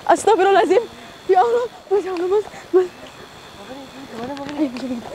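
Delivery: high, breathless, panicky urging; rising panic, pushing his friend on; frightened pleading. Acted. Ghost hunter frantically urges his companion toward the creature, high and panicky.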